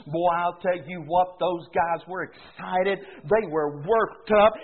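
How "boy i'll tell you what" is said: usual speaking voice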